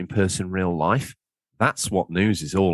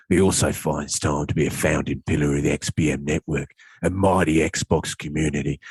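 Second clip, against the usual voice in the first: low, grand announcer voice, lightly done; hammed-up announcer intro; 4 semitones lower than usual. Host introducing his co-host in a mock-grand intro voice. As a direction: introduce the guest like a mock-epic trailer. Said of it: "And I talk nothing like that".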